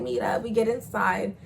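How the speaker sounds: plain reading voice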